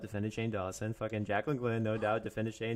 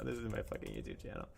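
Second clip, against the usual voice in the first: much lower and softer, grumbled to himself; low mutter; 12 semitones lower, 12 dB quieter than usual. Real, not acted. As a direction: mutter it under your breath. Repeated, low and quiet.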